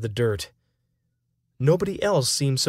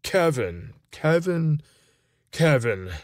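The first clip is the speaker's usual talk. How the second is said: put-on drunk; 3 semitones higher, slower than usual. Drunk friend repeating a name.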